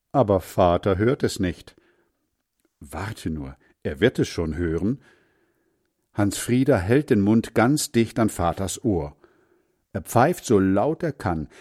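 usual speaking voice

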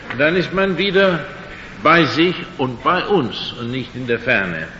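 usual speaking voice

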